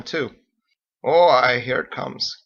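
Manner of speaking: ordinary delivery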